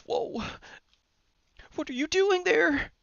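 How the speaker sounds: soft, high falsetto; playfully surprised falsetto; high put-on voice. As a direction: go way up high and keep it small.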